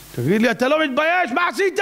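high-pitched indignant rebuke; mock a scandalized judge's reproach; retold outraged questioning; scolding. Heavenly court scolds the dreamer.

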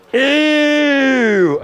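put-on high, loud childish "Ew!" of disgust. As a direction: squeal it like a grossed-out little kid. Boy sees nose drip in batter.

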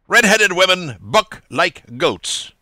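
trite saying. A narrator's reading. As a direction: drawl it out as a stale old saw. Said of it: "saying tritely".

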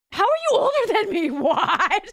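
laughing high and loud. Real, not acted. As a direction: laugh through the question. Laughing in disbelief.

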